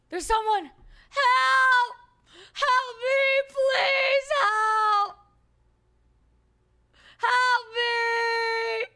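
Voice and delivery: shouting for help, voice cracking with desperation; calling for help. Acted. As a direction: yell to the passerby as if stranded. Stranded player calling to rescuer.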